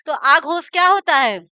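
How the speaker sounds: usual speaking voice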